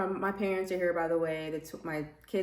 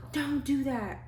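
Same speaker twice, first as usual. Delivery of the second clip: stern nurse; 7 semitones higher, slower than usual. Retold or imitated. Nurse scolding, quoted.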